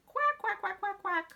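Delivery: very high duckling quacks; ducklings agreeing. Acted. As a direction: chirp along like happy ducklings. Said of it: "her ducklings agreed".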